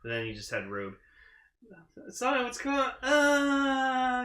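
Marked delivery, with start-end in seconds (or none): 2.1-4.2 high-pitched, dragged-out whine; hammed-up confused wail; mock wailing. Acting out a character wailing.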